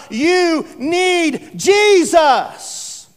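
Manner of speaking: the Spirit pleading at the heart's door; crying out. Acted. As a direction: stretch the name out like a calling voice. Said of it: "he's crying out".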